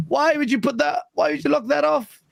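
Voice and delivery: high-pitched, exasperated mock complaint; indignant retold protest. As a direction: demand an explanation, pitched well up. Retold protest at locked game music.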